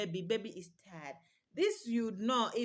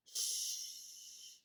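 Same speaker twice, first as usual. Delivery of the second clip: long soft shh like rain; 10 dB quieter than usual. Shown as a demonstration. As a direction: shush steadily to soothe a baby.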